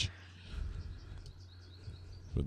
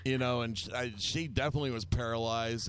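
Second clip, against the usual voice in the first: ordinary talk, nothing marked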